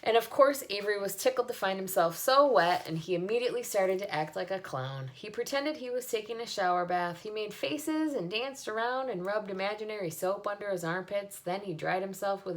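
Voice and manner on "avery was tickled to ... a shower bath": ordinary delivery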